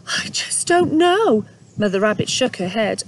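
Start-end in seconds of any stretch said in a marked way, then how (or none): 0.1-1.4 high, slow hopeless little voice; sad. Acted. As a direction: sigh it out in a small voice. Mother Rabbit character voice.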